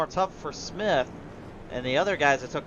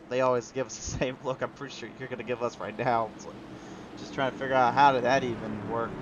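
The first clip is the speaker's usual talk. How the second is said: ordinary delivery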